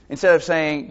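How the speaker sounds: ordinary talk, nothing marked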